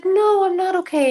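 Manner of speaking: high, plaintive dream-woman voice; high-pitched pretend upset; mock tearful woman. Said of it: "she's crying and crying and crying".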